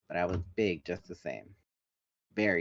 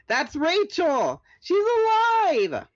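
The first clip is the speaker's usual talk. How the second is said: excited cry; 9 dB louder, much slower than usual. A narrator's reading. Spotting friend, excited cry.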